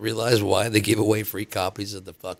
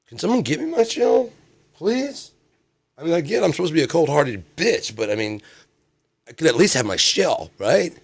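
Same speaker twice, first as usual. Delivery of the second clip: high, pleading whine; whiny plea; 8 semitones higher than usual. Retold or imitated. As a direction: beg for the shell.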